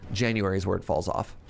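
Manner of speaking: normal talking voice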